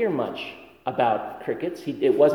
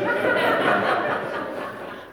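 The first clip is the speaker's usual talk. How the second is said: breathy laugh; 8 semitones lower than usual. Real, not acted. Laughing at own memory.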